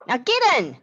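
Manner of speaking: high, loud command; shouted order. A narrator's reading. Marisol shouting at James to get in. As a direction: bark the order. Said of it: "his sister shouted".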